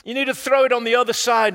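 loud, fast call across the water. Acted. Jesus shouting to the fishermen from the beach, loud and fast. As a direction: shout the instruction out to the boat.

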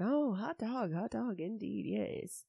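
sticky, overheated lazy voice; sweaty balls voice. Pretend. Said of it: "sweaty balls voice on".

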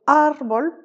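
"árbol" with a long, loud first syllable, ÁR-bol; stress on the A. Shown as a demonstration. Spanish teacher showing an exception, first A stressed in "árbol". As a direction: draw out and punch the ÁR of "árbol". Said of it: "sur le A".

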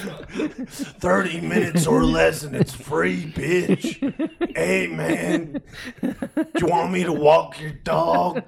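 stoner hobo. Retold or imitated. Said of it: "they would just be like".

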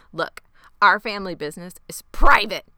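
loud, emphatic warning; stern shutdown. Retold or imitated. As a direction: lay down the law, punching the last words. Retold father ends the talk sternly.

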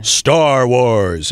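big, drawn-out seventies movie trailer voice; vintage voice-of-God trailer read; old-fashioned trailer announcer. Shown as a demonstration. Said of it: "the real old-fashioned kind of movie trailers".

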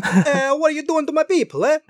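high-pitched cartoon mob boss; mob boss Giovanni. Mob boss confronting a kid, mobster voice. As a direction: do a hammed-up mobster confronting someone. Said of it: "he is a literal mob boss".

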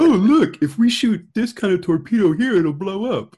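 high, loud excited dummy voice; gleeful clueless cadet; dumb college kid voice. Retold or imitated. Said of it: "stupid college kids that look at".

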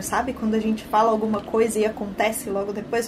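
ordinary delivery

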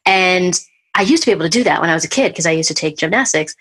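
normal talking voice

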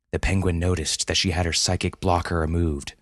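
plain reading voice

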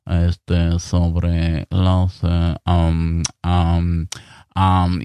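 low, dragging voice full of ums; reluctant podcaster. Retold or imitated. Mocking a podcaster who sounds forced to record. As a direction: mumble it like you're forced to be there. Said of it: "es como si fuese obligado".

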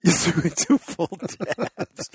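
laughing repeat. Real, not acted. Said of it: "repeating with a laugh".

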